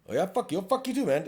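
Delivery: mock a heated swearing exchange; heated back-and-forth. Officer trading insults with a driver in a retold spat, heated.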